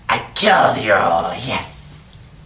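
hissing little goblin. Acted. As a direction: snarl it like a goblin. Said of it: "He says".

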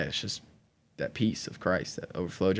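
ordinary talk, nothing marked

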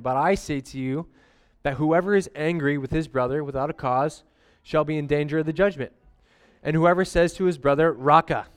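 measured scripture reading. Acted. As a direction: read the Bible passage with weight. Reads scripture passage aloud.

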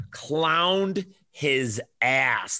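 slow, stretched words hammering the point; drawn out emphasis. Real, not acted. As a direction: drag it out for emphasis. Hyping a domination stat.

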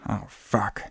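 low, hushed curse; quiet, deflated swearing; sinking realisation. Retold or imitated. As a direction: say it softly, as dread sinks in. Officer realises the untraced phone.

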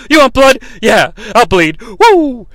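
loud, worked-up wrestler boast; Ric Flair. Acted. Wrestler offers to bleed for fans, hammed-up promo. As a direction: taunt the crowd, then a high whoop. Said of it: "hear that Ric Flair is gonna bleed".

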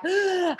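mock gasp. Reacting to a social media comment.

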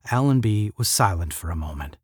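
ordinary delivery, nothing marked

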